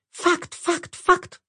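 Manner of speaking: very high squeaks from a belly; squeaky tiny voices. Acted. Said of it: "раздалось из живота". (тоненький писк)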